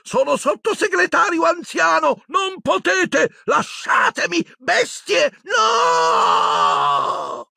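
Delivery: very high shouted pleas, breaking into a cry; terrified screaming. Acted. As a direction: scream and beg as they grab her. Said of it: "sentì strillare la Umbridge".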